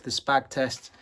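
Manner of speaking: ordinary talk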